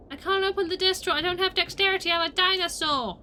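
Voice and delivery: loud, high complaint; playful high-pitched whine-shout; exasperated dinosaur wail. Pretend. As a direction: protest loudly in mock despair. Frustrated as dinosaur in game.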